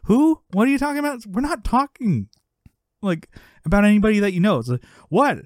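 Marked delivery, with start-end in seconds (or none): 0.0-4.6 retold protest. Sister answers the yelling dad. 5.1-5.4 loud, high mock protest; yelling dad.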